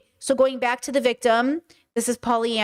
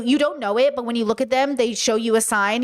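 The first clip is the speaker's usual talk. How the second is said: ordinary delivery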